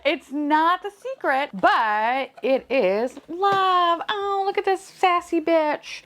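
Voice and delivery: high, drawn-out squealy delivery; slow high-pitched cooing excitement; gushing reveal. Real, not acted. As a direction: stretch each word high as you reveal it. Reveal: not secret but love.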